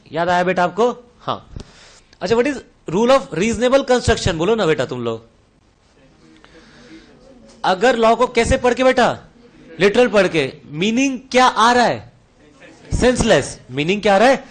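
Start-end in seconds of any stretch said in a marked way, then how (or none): none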